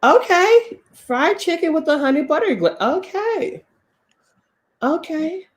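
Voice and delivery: high-pitched excited okays; delighted food reaction. Real, not acted. Excited reaction to fried chicken.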